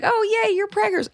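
mock-sweet, very high-pitched squeal of congratulations; sugary-sweet gusher. Imitating sweet followers congratulating her, sugary mock gushing. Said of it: "people who are so sweet".

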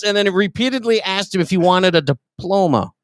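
ordinary talk

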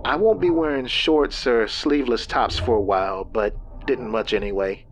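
a hint of a tired wife; lightly voiced, worn-out woman; weary woman. Lynn laughing it off.

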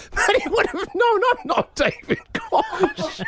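high, slow laughing. Real, not acted. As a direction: laugh through the words. Laughing at himself.

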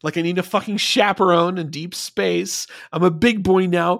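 hammed-up kid insisting he's all grown up; mock bratty defiance. Mocking Archer as defiant kid.

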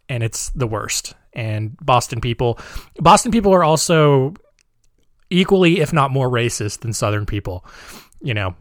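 ordinary delivery

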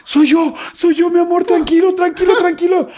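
very high, urgent voice of a partner; Rebeca. Retold or imitated. Partner bursting in on the terrified man, high urgent reassurance. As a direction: imitate her frantic reassurance, high and slightly strained. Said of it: "y era Rebeca diciéndome".

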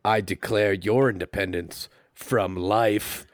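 slow, solemn hero punchline; action hero one-liner. Acted. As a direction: deliver it as a weighty pre-kill quip. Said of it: "he pops up and he says".

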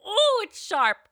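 high, gasping reaction to a sour sip; startled by tartness. Real, not acted. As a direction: yelp at the sharp, sour hit.

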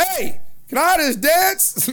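high, slow, loud awkward ask; mocking nervous guy. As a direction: ham up a nervous guy asking.

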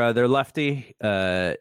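ordinary delivery

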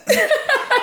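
bright squeal of a laugh; a short high laugh at herself. Real, not acted. Guest embracing the mum label, high laugh.

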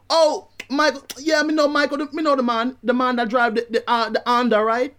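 high-pitched imitated Jamaican neighbour; terrible Jamaican accent. Neighbour recognises the man, terrible Jamaican accent. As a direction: do a bad Jamaican patois. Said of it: "This is a terrible accent".

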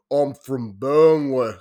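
put-on real broad Burnley accent, drawn out slowly. Yorkshire speaker showing how Burnley people talk, broad Burnley accent. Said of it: "like, a real broad accent".

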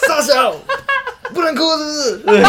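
high, loud laughing mock yell; mock drunk yell. Drunk friend yelling at strangers, laughing mock yell. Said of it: "跟人家大吼大叫".

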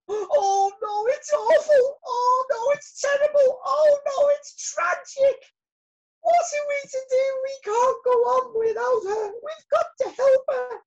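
very high, loud wail of alarm; squealing storybook bee in a panic; panicked cry. A narrator's reading. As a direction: cry out in hammed-up despair. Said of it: "cried Lizzie".

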